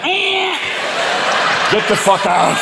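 a cat snapping at you to clear out; cat warning. Acted. Cat in the doorway warning you off, shouting. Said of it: "your cat is spread-eagled in the doorway".

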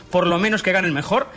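normal talking voice

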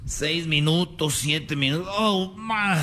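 ordinary delivery

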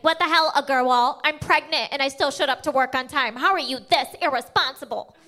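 lightly voiced scolding manager; half-hearted mock telling-off from a pregnant boss; a hint of an irate boss; mock angry manager. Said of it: "My manager was in my face".

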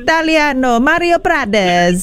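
hammy, slow Italian voice; Italian accent. Pretend. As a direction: overplay a cartoon Italian. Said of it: "say it in the most Italian accent".

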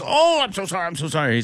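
put-on high apologetic Indian accent, drunk; imitated Indian accent. Drunk passenger apologising for smoking, mock Indian accent. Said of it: "Indian accent".

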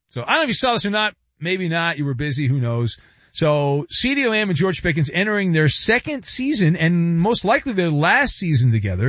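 usual speaking voice

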